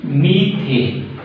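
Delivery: slow drilled repeat. Shown as a demonstration. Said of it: "teacher repeats the name".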